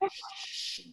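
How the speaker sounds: a soft hiss of shh; shushing. Shown as a demonstration. Teacher shushing the class so Claire can wake. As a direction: hush them with a long shh. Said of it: "Everybody say".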